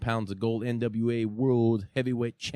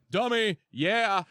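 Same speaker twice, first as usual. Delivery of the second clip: Eli Drake catchphrase; 9 semitones higher, slower than usual. Retold or imitated. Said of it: "You dummy".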